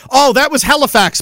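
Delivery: normal talking voice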